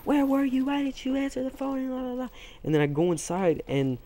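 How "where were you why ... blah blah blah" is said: high-pitched imitation of a scolding grandma; mock yelling grandma. Grandma telling off grandson for not answering, mock high-pitched yell. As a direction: put on a much higher voice for mock nagging. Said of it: "his grandma just starts yelling at him".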